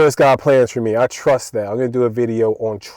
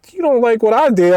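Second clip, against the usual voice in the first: high-pitched, indignant echo question; retold incredulous comeback; 10 semitones higher than usual. Worker answering coworker's complaint, high incredulous comeback. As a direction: throw it back in disbelief.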